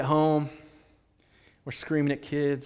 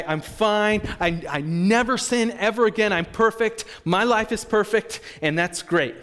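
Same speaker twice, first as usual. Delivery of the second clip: loud, higher-pitched boast of a perfect life; mock self-satisfied; 5 semitones higher, 7 dB louder than usual. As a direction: play the believer who insists everything is great. Acting out a self-satisfied believer.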